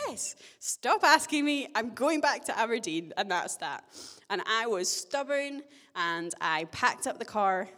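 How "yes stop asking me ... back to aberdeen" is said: exasperated retort. Retold or imitated. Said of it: "I was like".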